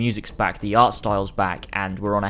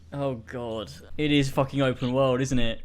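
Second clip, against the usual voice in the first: ordinary delivery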